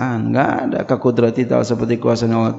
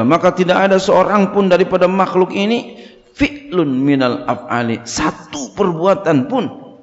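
louder, measured recitation of the Arabic text; kitab reading; 4 semitones higher, 3 dB louder than usual. Acted. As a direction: read the kitab aloud like a pesantren teacher. Rereads kitab text aloud. (membaca kitab dengan lantang)

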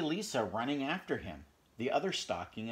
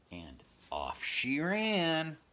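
slow refrain; 5 semitones higher, 6 dB louder, much slower than usual. A narrator's reading. Refrain drawn out.

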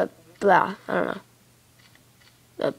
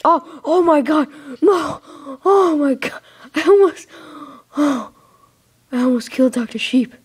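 panicked shriek; 6 semitones higher, 6 dB louder than usual. Real, not acted. Nearly killing his pet sheep.

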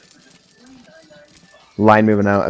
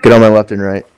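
loud battle command; shouting orders over battle; 7 dB louder than usual. Real, not acted. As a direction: call the line over the noise. Officer calling men into line.

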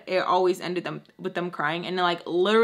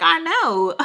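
high put-on voice agreeing; sassy inner voice; 9 semitones higher than usual. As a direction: answer yourself in a high sassy voice. Inner voice answering.

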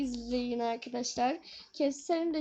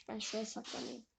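whispering while hiding; 5 semitones lower, 13 dB quieter, faster than usual. Real, not acted. Hiding from Granny.

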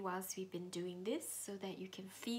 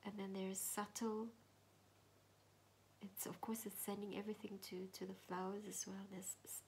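meditation whisper; 3 semitones lower, 5 dB quieter than usual. Acted. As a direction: whisper gently, guiding inward.

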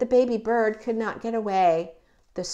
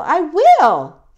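loud, high, emphatic vow; determined little baby bird; 6 semitones higher, 13 dB louder than usual. Acted. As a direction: declare it with storybook resolve. Bird vows to find mother.

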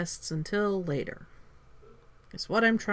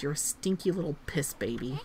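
ordinary talk, nothing marked

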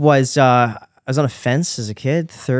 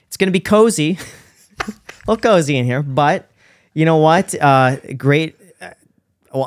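upbeat sales pitch, higher and louder; real estate agent; 5 semitones higher, 5 dB louder than usual. Pretend. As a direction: sell the house like an eager realtor. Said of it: "You're a successful real estate agent".

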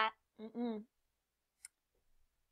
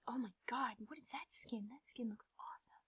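hushed, impressed whisper; whispered awe; 12 dB quieter than usual. Real, not acted. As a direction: whisper it in amazement. Awed by another player's skin.

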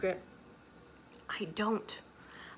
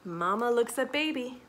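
plain reading voice

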